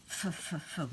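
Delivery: soft hissed f sounds; teacher sounding out "f"; phonics sound. Shown as a demonstration. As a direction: say "f" as bare breath. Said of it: "We need F at the beginning".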